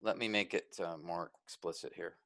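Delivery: soft, low, half to yourself; quiet working aside. Real, not acted. Teacher editing the text for the class, quiet aside. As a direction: trail into a low murmur while busy.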